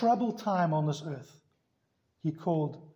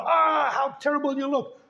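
high, loud teasing jeer; mocking disgust; 9 semitones higher, 5 dB louder than usual. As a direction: taunt the child, pitched high and loud. Teens mock the messy child.

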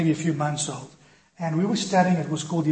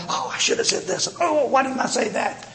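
breathy, rising regret at a missed chance; half-whispered oh, then a higher frustrated why; self-reproach; 7 semitones higher than usual. Retold or imitated. To himself after the Witnesses leave, in rueful regret.

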